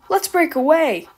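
mischievous trucks. Acted. Trucks plotting against Edward, high and eager. Troublesome trucks plot to escape.

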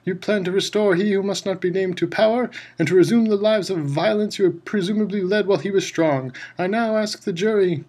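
stern bellow. A narrator's reading. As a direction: keep the anger driving. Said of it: "bellowed Mister Crouch".

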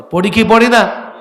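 high, loud challenge; shouted question. Real, not acted. Preacher challenging listeners, loud question. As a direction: challenge the crowd loudly. Demands crowd's reply.

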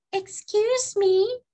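very high, slow little bird; high-pitched sparrow character. A narrator's reading. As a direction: pipe up like a polite sparrow. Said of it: "said the sparrow".

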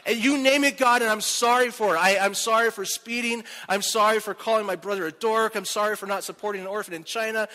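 hammed-up begging prayer; mock pleading. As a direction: rattle off sins to God. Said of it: "I started pleading with God".

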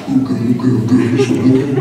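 low, hammed-up girlfriend talk. Imitating her LA friend talking to her, low hammed-up homegirl voice. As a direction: put on a sassy LA homegirl voice.

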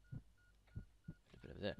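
slow, soft whisper. Real, not acted. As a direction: breathe it out softly. Unvoiced slow aside.

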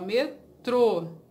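METRÔ with a stressed, closed final Ô; accent on the O. Shown as a demonstration. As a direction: split Metrô and land on the TRÔ. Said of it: "Acento aqui, ó, no O". ("Metrô" separado em sílabas, com acento no Ô final)

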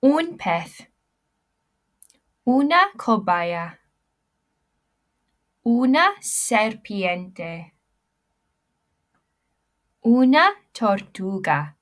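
slow, loud model of Una cobaya, Una serpiente, Una tortuga in a Spanish accent. Shown as a demonstration. Teacher models feminine pet words in a Spanish accent, loud. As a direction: stretch each word clearly in a Spanish accent. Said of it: "Repeat after me".

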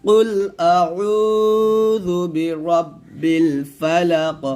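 drawn-out melodic আউযু বিরাব্বিল ফালাক; slow recitation. Shown as a demonstration. Teacher recites the verse for students to echo, slowly. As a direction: intone it slowly, all joined. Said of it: "আবার বলেন".

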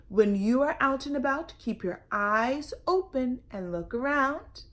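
put-on lively, loud read-aloud for children; storytelling read-aloud. A narrator's reading. Librarian reading a picture book to kids, lively.

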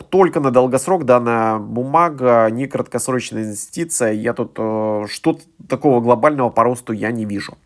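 usual speaking voice

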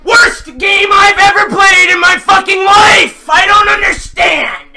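furious crying shout; yelling at the game in despair; raging gamer. Real, not acted.

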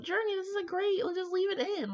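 a playful, high hype voice; mock self-encouragement. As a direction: hype yourself up, half joking. Quoting what she'll tell herself.

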